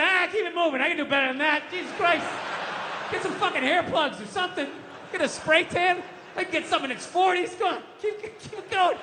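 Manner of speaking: lightly voiced camp brush-off. Older man rejecting the comedian, with a light camp sass. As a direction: wave him off with sass. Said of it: "some queen in his sixties is like".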